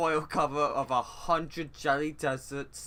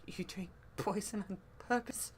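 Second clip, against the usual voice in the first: soft, higher character voice; timid disbelief; 5 semitones higher, 9 dB quieter than usual. Acted. Character reacting to someone who drinks poison, timid. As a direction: ask it small and hushed, taken aback.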